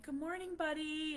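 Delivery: high sing-song good morning; high cooing wake-up call; wake-up greeting. Retold or imitated. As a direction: coo a high morning hello to a sleepy kid. Mother waking her son.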